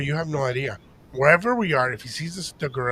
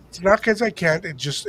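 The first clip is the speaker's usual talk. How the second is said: normal talking voice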